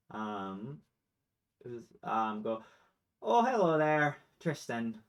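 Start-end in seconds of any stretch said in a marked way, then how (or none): 3.2-5.0 lightly voiced satyr making a big greeting. Game master's satyr greets Tristan at the door, hearty greeting.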